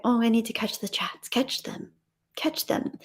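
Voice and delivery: hushed, near-whispered self-talk; soft, whispery aside to yourself; muttering to self. Real, not acted. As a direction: think aloud under your breath. Muttering to self while reading chat.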